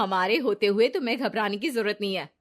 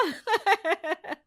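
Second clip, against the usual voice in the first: high snide little laugh; mocking laugh; 10 semitones higher than usual. Jealous Ritu laughs at Riya's play-school plan, snide laugh. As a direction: laugh it off with hidden envy. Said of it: "Ritu को रिया की बात से जलन होती है".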